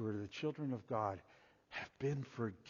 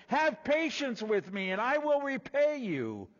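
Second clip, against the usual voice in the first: higher, louder plea for patience; pleading slave; 12 semitones higher, 7 dB louder than usual. Acted. Fellow slave pleading for time, higher and louder. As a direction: beg for mercy from the ground. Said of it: "began to plead with him, saying".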